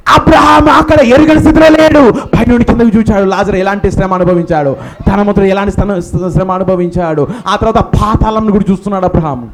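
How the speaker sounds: ordinary talk, nothing marked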